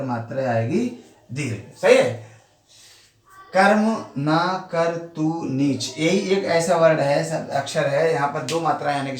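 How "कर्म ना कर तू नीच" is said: "कर्म ना कर तू नीच" spoken very slowly, syllable by syllable; slow syllable reading. Shown as a demonstration. Teacher counting syllables for students, very slow reading. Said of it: "जो मात्रा कौन सी आएगी".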